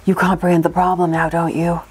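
low whispered cartoon villain. Reading villain dialogue aloud.

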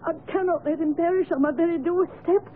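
sobbing, broken explanation; weeping confession. Acted. Servant confessing about her starving brother, weeping. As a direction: confess through tears, breath catching. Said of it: "the weeping unfortunate woman".

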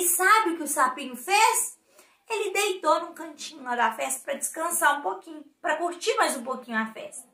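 plain reading voice

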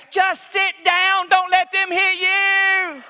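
high, very slow mock scream from the stands; mom screaming. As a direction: yell it out like an anxious mother. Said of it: "She would scream out".

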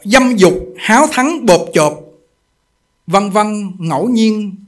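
loud, slow, declaiming read of scripture; reading the master's text aloud. Real, not acted. Monk reading Master Yin Guang's words to the assembly, loud and slow. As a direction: proclaim the teaching to the hall. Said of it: "chúng tôi đọc quý vị nghe".

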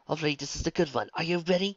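put-on brisk encouraging older woman; midwife voice. A narrator's reading. Midwife readying the birth.

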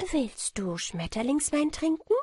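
high, bright-pitched call of a little fairy queen. A narrator's reading. The fairy queen calls to the child, offering butterfly wine, high and eager. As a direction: lift the voice high and invite eagerly. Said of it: "rief sie".

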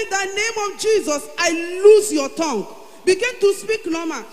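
loud, high commanding prayer; commanding shout. Retold or imitated. Shouting a prayer over the phone to her mother. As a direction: rebuke the silence, shouting.

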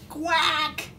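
high, sharp storybook quack; exasperated high quack; angry duck quack. A narrator's reading. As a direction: snap out one furious duck quack. Duck totally snaps.

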